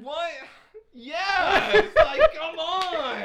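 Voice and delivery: loud, high, drawn-out exclamation; yelled, half laughing; exasperated outburst. Real, not acted. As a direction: burst out in comic exasperation. Mock outburst at the scene.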